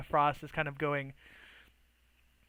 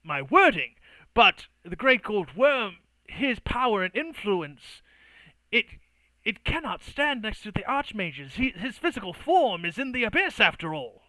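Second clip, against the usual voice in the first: protesting mage; 4 semitones higher, 4 dB louder than usual. Acted. Mage pleading his case to Artorias, emphatic. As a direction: argue like a pompous arcane envoy. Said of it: "he kind of looks to Artorias and kind of goes".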